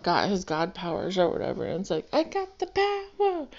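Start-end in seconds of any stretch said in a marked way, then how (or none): 2.1-3.5 much higher, gleeful movie quote; squealing, triumphant movie-hero impression; Jim Carrey as Bruce. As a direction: crow it like a man who just got God powers. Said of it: "the movie Bruce Almighty, Jim Carrey".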